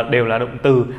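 ordinary talk

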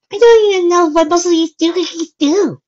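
put-on very high, loud girl's voice; Mabel's voice. A narrator's reading. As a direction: voice a kid pleading ignorance, high and loud. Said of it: "said Mabel".

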